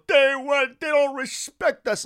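loud, high mock protest of an officer; aggrieved police speechmaker. Mocking a cop's speech like a Spider-Man scene, loud aggrieved impression. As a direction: imitate a police speech, hammed up and high-pitched. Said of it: "You got the aw shucks guy, that speech in N Y P D".

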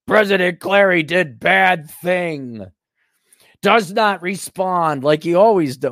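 slow, drawn-out broadcast parody; mock news reporter. News network reporting on the missing president, mock newscaster. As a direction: put on a ponderous news-report voice.

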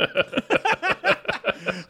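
laughter. Real, not acted.

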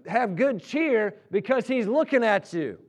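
fervent preacher building a story to its peak; impassioned preaching. Real, not acted. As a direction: preach it at full force, driving each phrase home. Preaches story's climax at full force.

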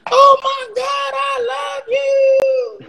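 very high, drawn-out squeal of joy. Retold or imitated. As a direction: squeal it like an overjoyed superstar. [name] runs up to him.

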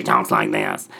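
deep raspy impression of a straight-talking boss; raspy imitation. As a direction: growl it low like a raspy Southern lady. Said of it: "and it's real raspy".

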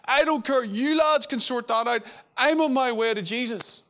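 excitedly brushing off the boat and fish; eager Peter. Retold or imitated. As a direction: Peter can't wait to reach Jesus. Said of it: "And he's like".

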